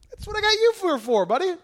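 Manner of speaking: high, loud jokey reply; teasing God voice. Acted. God answering the preacher's complaint, high teasing reply. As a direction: toss it back with a playful grin.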